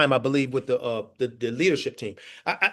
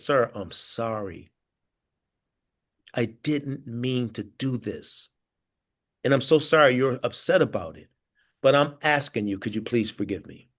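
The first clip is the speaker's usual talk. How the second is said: humble apology; 7 semitones lower than usual. Retold or imitated. Retold humble apology on road.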